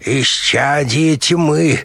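high, very drawn-out raving; crazed babbling. A narrator's reading. Slapped doctor raving at the colonel, crazed. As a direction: rave slowly at a high pitch. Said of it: "забормотал Штреллер".

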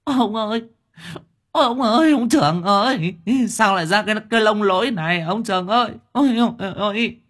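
put-on high, loud wailing old wife. A narrator's reading. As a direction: wail for the husband as if he died. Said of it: "Bà gào mồm lên". (vợ già gào khóc thảm thiết)

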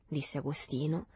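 ordinary delivery, nothing marked

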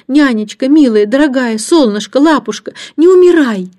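put-on high girlish pleading, building to a loud cry; wailing plea. A narrator's reading. Антея begging her beloved nanny to live, wailing plea. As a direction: beg desperately with every endearment. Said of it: "свои вопли Антея".

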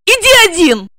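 high, loud angry shout. A narrator's reading. Regina shouts at Igor as she runs away, loud and high. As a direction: snap it back while running off.

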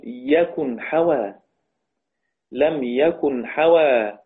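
يكن حوى spoken very slowly, each syllable drawn out; slow recitation. Shown as a demonstration. Teacher pronouncing Yaqub's reading of يكن حوى, very slowly. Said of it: "كما لفظ به".

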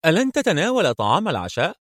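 voiced wife. A narrator's reading.

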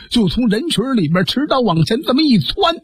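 plain reading voice

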